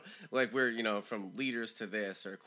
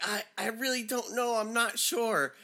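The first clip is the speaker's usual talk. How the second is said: high, hesitant imitation; flustered pupil; 11 semitones higher, 3 dB louder than usual. Seventh-grader put on the spot, high and flustered. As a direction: mimic a rattled student, pitched up high.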